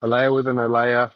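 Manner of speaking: usual speaking voice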